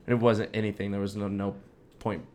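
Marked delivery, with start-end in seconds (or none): none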